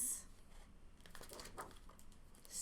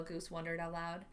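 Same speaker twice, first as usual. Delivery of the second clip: usual speaking voice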